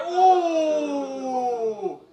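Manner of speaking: loud, high, long falling hoot; hammed-up crowd-style whoop; mock-scandalised "ooh". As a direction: jeer a drawn-out "ooh" at the put-down. Mock reaction to audience insult.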